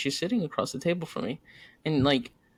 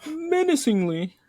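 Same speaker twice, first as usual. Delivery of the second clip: slow, higher dramatic voice with a laugh; mock narrator; 10 semitones higher, 3 dB louder, slower than usual. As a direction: narrate her glare like a cheesy movie. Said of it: "and I'm just like".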